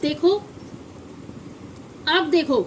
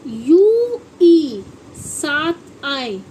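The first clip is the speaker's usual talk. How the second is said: each word stretched very slowly; very slow emphasis; 3 dB louder, much slower than usual. Shown as a demonstration. Teacher slowly stresses the letter pair for pupils. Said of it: "क्या आए".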